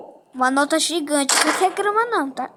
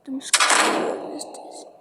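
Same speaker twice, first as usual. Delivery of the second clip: whispering. Acted. Doctor voice before surgery.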